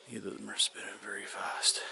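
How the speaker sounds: whisper. Real, not acted. Quietly checking the whirly birds.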